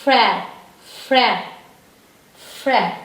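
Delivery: Frère as "frehr", each a separate falling syllable; French pronunciation. Shown as a demonstration. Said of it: "And this is silent".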